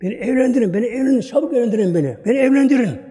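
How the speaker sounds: put-on young man begs the gathering to marry him off; urgent pleading. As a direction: plead fast, as if there is no time left. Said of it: "Öyle böyle".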